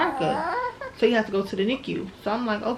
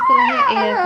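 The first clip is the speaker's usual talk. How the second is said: high, loud amused squeal; delighted high squeal; amused exclaim; 9 dB louder than usual. Real, not acted. Amused aside about baby's hair.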